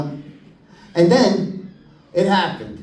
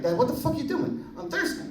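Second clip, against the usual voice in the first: put-on high, soft whiny little-boy voice; a boy whining to his dad, high and soft; whiny kid; 8 semitones higher, 9 dB quieter than usual. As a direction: whine it like a thirsty kid. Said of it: "I'm like".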